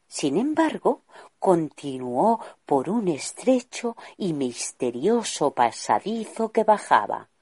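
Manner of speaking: plain reading voice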